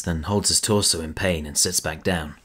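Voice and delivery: ordinary talk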